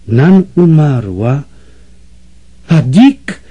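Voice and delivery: slow, stunned disbelief rising into a cry on the last words; a mother hearing the boy she loved is dead, calling out in shock. A narrator's reading. Mrs Weldon crying out at Negoro in stunned disbelief. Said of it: "zawołała pani Weldon".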